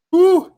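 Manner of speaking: loud pained whew. Real, not acted. Blowing out from the burn.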